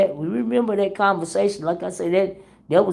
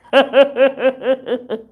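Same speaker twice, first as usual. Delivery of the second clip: laugh; 8 semitones higher, 5 dB louder than usual. Real, not acted. Laughing at viewer.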